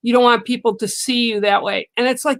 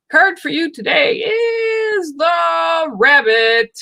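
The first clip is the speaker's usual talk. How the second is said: card reveal; 8 semitones higher, slower than usual. Real, not acted. Announcing the viewer's card to them, slow and raised. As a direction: draw it out like revealing a prize.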